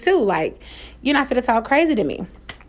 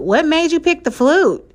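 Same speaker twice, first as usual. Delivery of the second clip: higher-pitched mock disbelief; imitating incredulous dad; 6 semitones higher than usual. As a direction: question the choice like a scoffing dad. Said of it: "why are you saying it like that?".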